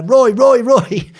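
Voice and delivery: high, loud calling out across a crowd; shouting a name. Retold or imitated. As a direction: yell Roy to grab the photographers. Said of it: "the paparazzi going nuts".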